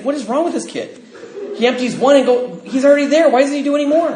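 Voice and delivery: high, laughing, baffled sponsor voice; mock disbelief at the kid; incredulous sponsor. Sponsor laughing in disbelief at the kid.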